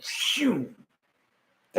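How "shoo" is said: higher-pitched whooshing noise; imitated whoosh. Telling a friend how the blur moved, mimicked whoosh. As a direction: mimic the screen smearing with a whoosh. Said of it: "motion blur is like".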